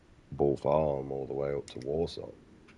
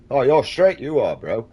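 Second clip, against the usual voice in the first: high, loud mocking of a lad; mock bro voice; 8 semitones higher, 11 dB louder than usual. Mocking a mate who calls him straight, loud cheeky bro voice.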